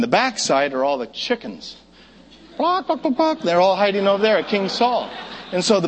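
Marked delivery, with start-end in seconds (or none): 2.6-3.4 barking. Acted. Mocking the chickens.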